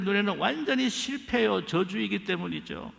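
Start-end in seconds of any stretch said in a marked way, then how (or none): none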